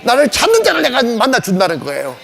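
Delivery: ordinary talk, nothing marked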